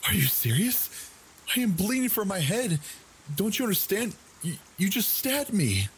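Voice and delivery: a bleeding woman screaming at her friend; panicked disbelief. Retold or imitated. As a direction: yell at him in shock. Bleeding, arguing with her attacker.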